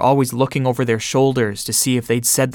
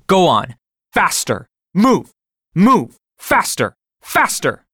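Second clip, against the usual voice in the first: loud, much higher barked commands; ordering; 7 semitones higher, 3 dB louder, slower than usual. Retold or imitated. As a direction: drive him down the platform with shouts. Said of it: "I ordered".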